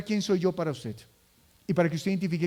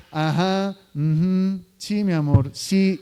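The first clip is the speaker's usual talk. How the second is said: absent-minded filler answers, a bit louder; distracted parent; 4 dB louder, slower than usual. Parent half-listening to a child, distracted replies. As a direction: mumble replies without looking up. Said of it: "le está hablando a ese niño como".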